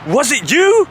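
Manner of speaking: high questioning lilt, mock suspicion; Bob Ryder. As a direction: imitate a friend quizzing you, voice rising. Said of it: "Bob Ryder looks over at me".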